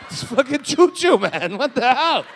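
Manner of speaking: loud, high incredulous outburst; mock disbelief at the fuss; baffled attendant. Comic attendant mocking the wife's drama, loud. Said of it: "she's like".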